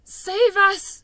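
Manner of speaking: loud, high panicked scream; terrified giant screaming in his sleep. A narrator's reading. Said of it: "screamed the flesh lump eater".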